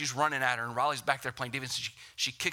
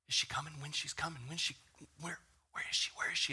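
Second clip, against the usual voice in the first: softer, anxious whispering guests; hushed whisper; 8 dB quieter than usual. Retold or imitated. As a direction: whisper it like guests waiting for the bride. Wedding guests wondering, whispered.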